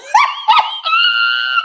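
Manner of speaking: a high, loud shriek of laughter. Real, not acted. Streamer shrieks with laughter at her own joke, loud squeal.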